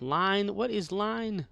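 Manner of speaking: high-pitched mock actor asking for his line; imitated forgetful actor. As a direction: mimic an actor who has lost his line. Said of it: "he couldn't remember the line".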